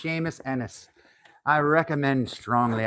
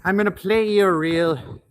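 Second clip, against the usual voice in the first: put-on Irish accent; 3 semitones higher, 5 dB louder than usual. Introducing his father's reel to viewers, in an Irish accent. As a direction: announce a tune like an Irish pub musician. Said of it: "back to the Irish accent we go".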